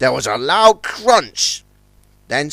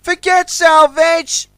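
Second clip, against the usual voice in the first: cried out; 13 semitones higher, 4 dB louder, slower than usual. A narrator's reading. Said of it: "cried Tencent".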